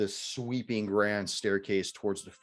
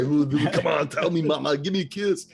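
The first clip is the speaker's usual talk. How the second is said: high, loud puppet voice; hammed-up dummy talking back; ventriloquist dummy; 7 semitones higher, 8 dB louder than usual. Butler voicing his mother like a puppet, high and loud. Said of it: "This is a ventriloquist".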